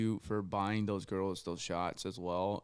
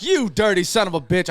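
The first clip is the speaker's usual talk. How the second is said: retold outraged call-out, half laughing; mock accusation; 10 semitones higher, 14 dB louder than usual. Son catching friend eyeing his mom, mock accusation.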